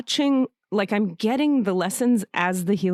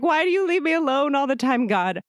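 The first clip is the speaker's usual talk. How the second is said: higher, pleading and demanding at once; demanding of God; 6 semitones higher than usual. Retold or imitated. Demanding God stop leaving her alone, raised and hurt. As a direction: call out to God, hurt and insistent. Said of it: "as I'm demanding God not leave me alone".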